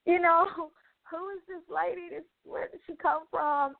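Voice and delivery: high, breathless disbelief; voicing the moment a stranger paid up; retold shock. Retelling to viewers, her stunned high-pitched disbelief at the stranger. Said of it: "I'm, like, super shocked".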